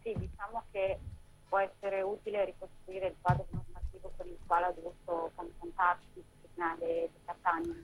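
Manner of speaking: quiet, distant voice over the phone; too far from the phone mic; faint phone voice. Real, not acted. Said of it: "ti sentiamo un po' bassa". (voce bassa e lontana al telefono)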